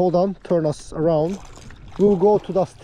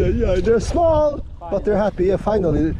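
normal talking voice

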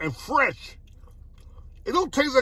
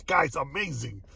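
ordinary talk

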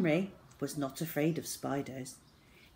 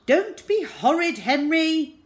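dad's raised, high shout; angry shout; 11 semitones higher, 9 dB louder than usual. A narrator's reading. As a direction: shout a telling-off at your son. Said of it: "shouted Dad".

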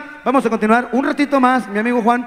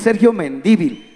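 "Mendívil" pitched lower, stressed men-DÍ-vil; stress on first I; 5 semitones lower than usual. Shown as a demonstration. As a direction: show the accent on DÍ in "Mendívil". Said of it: "póngale acento en la primera I".

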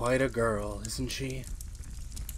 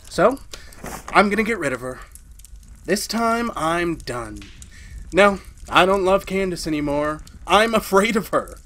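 louder, higher, worked up; agitated resolve; 5 semitones higher, 5 dB louder than usual. Acted. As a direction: declare it in rising agitation. Husband resolves to get rid.

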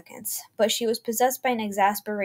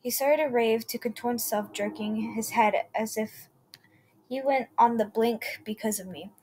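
ordinary delivery, nothing marked

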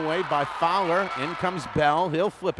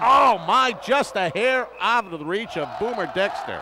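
excited; 5 semitones higher, 7 dB louder than usual. Real, not acted. Near miss called live.

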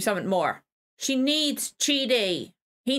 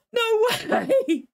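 thrilled laughing disbelief; 8 semitones higher, much slower than usual. Real, not acted. Fan moved by Ted Danson.

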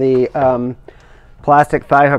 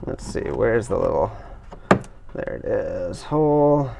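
quiet mutter to himself; muttered aside; 6 semitones lower, 10 dB quieter than usual. Real, not acted. Looking for the hole, muttering quietly to himself. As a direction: drop to a soft mutter while searching. Muttering while searching for part.

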